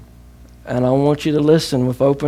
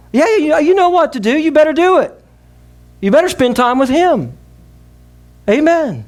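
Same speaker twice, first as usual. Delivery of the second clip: preaching at full force; 11 semitones higher than usual. Acted. Preacher urging congregation.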